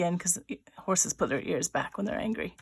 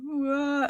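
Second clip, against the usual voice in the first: high cartoon wail; mock bawling; 7 semitones higher, 4 dB louder than usual. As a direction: let out a pretend crying "wah". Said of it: "'cause he's bawling so hard".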